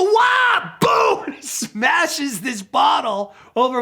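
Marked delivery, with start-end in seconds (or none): 0.0-1.2 high, loud startled yelp; startled shout. Retold or imitated. Sebastian reacting as Wayne smashes a bottle on him, loud high yelp.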